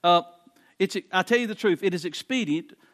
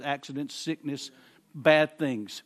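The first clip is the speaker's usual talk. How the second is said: normal talking voice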